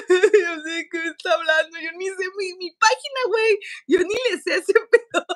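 high, loud laughing through tears; laugh-crying. Real, not acted. Laughing while crying retelling. (contando su llanto, entre risa y lágrimas)

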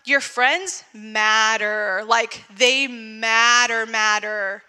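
slow, deliberate stress on matter; emphatic slow point. Real, not acted. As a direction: drive the point home slowly.

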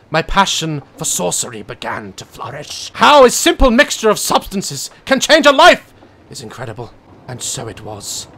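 loud, high, theatrical reading of a diary; grand storyteller narration; dramatic confession reading. Acted. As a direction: read it like a haunted confession. Reads the witch's confession aloud.

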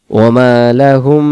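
ওয়া মা লাহুম with the m nasalized and held; ওয়া মা লাহুম with a held nasal m; with ghunna. Shown as a demonstration. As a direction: give লাহুম a humming nasal m. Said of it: "ওই রকম ভাবে গুণনা করে পড়তে হবে".

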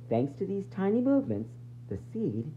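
ordinary talk, nothing marked

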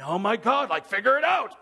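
frustrated outburst at a needy caller; exasperated. Retold or imitated. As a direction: vent like you've had enough. Irritated pastor venting.

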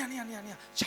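put-on a girl bubbling over with joy; excited child. The girl calling her big brother, thrilled about the chocolates.

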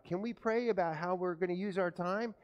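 normal talking voice

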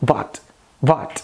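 "what" starting with V; Indian English accent. Shown as a demonstration. Teacher contrasting W and V, Indian accent. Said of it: "Já no inglês indiano soa".

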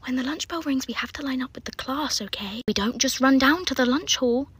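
hushed mock-patient telling-off; scolding teacher voice. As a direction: talk down to a child, measured and quiet. Teacher gently scolding a child.

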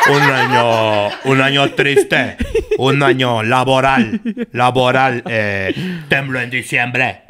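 slow, low, solemn newscaster voice; drawn-out lines like a grave TV anchor; López Dóriga. Pretend. Mocking a newsman's year-end recap, slow and deep. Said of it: "yo parezco López Dóriga".

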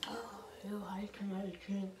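soft unvoiced whisper. Real, not acted. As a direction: breathe it out as a whisper. Whispered aside.

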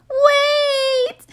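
high, crying shout; desperate yell. Acted. Girl yelling after the departing truck, tearful shout. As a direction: call out in tears.

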